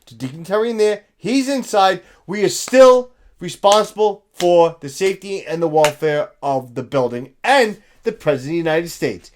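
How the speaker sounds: ordinary delivery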